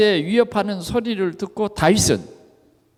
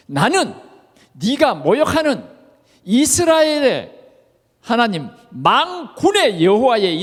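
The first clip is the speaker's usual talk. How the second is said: preacher's acted battle declaration, raised pitch; declaring cry; 4 semitones higher, 3 dB louder than usual. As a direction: call out a defiant challenge in short phrases. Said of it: "예, 외칩니다".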